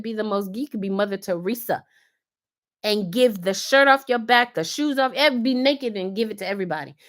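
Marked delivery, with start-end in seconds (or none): none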